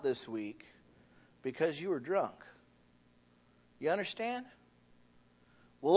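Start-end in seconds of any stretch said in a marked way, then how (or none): none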